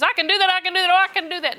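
high, eager repeating; bursting with eager yes; excited woman jumping up. Retold or imitated.

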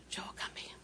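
barely audible whispered summons; angry whisper. Retold or imitated. Mother calling her child when really angry, whispering. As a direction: whisper it as a mother holding in anger. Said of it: "I'd whisper".

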